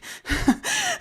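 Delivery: high nervous giggle; nervous laugh. Retold or imitated. Greeting the wrong woman, a high nervous giggle. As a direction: laugh high to cover the awkwardness.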